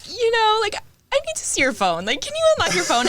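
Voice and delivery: high-pitched, awkward ask; acting out an uneasy favor-asking; awkward request. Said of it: "just awkwardness".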